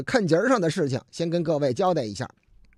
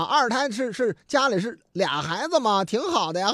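ordinary talk

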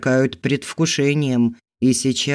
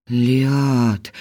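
convulsive exhale. A narrator's reading. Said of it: "судорожно выдохнул мужчина".

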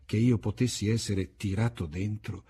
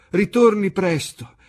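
put-on old waiter's voice; 6 semitones higher, 6 dB louder than usual. A narrator's reading.

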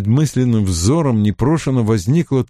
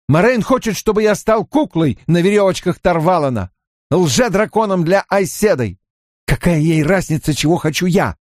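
furious tirade; 8 semitones higher than usual. A narrator's reading. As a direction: rail against being used. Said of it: "слова неудержимо и яростно рвались с губ". (яростная тирада, высоким голосом)

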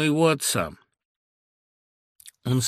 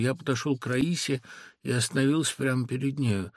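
ordinary delivery, nothing marked

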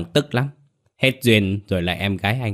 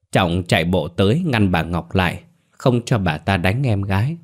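ordinary delivery

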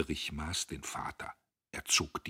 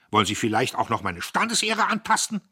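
loud, higher indignant question; affronted; 7 semitones higher, 10 dB louder than usual. Acted. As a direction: bristle at the insult. Diederich empört. (empörte laute Frage)

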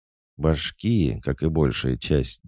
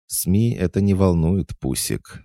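teasing, higher and louder jab; mocking; 8 semitones higher, 5 dB louder than usual. A narrator's reading. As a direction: needle him with mock sweetness. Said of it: "насмешливо произнесла незнакомка".

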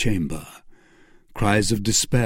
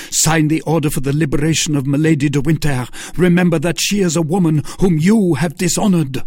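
louder, higher, fast insistence; fervent, rapid-fire order; urgent demand; 6 semitones higher, 5 dB louder, faster than usual. A narrator's reading. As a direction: press him hard to sign. Fanatic demands the Duke sign.

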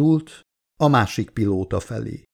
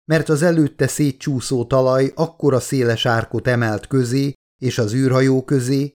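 ordinary delivery, nothing marked

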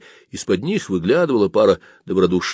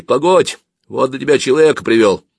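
loud, raised call to wait; hailing; 6 semitones higher, 5 dB louder than usual. Acted. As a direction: call after someone about to leave. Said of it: "окрикнул мой провожатый". (громкий оклик)

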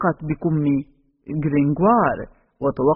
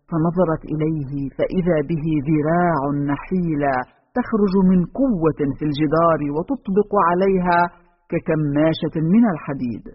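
ordinary delivery, nothing marked

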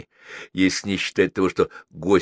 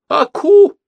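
loud high-pitched yelp; sudden manic cry; cried out; 11 dB louder than usual. A narrator's reading. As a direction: burst out in a piercing shout. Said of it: "закричал консул".

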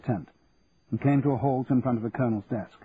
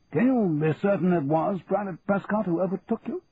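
puzzled colonel; 7 semitones higher than usual. A narrator's reading. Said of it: "looking puzzled".

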